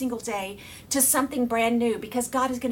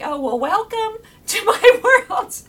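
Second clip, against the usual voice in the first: slow, higher, laughing reply; 10 semitones higher, 9 dB louder, slower than usual. Retold or imitated. Answering her husband Jay, laughing and amused. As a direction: laugh through the welcome. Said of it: "at first I started cracking up".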